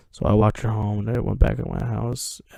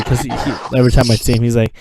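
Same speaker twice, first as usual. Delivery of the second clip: ordinary delivery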